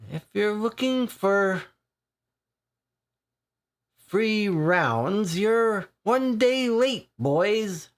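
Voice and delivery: put-on grinning Irish regular ribbing the boys; jovial Irish accent with a grin. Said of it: "with an Irish accent".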